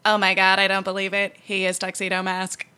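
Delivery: flat dub line. Retold or imitated. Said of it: "her goes".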